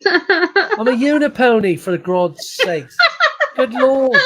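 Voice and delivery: very high, slow squeaky pony voice; unipony voice. Pretend. Streamer in unicorn filter gushing over eyeshadow, high squeaky voice.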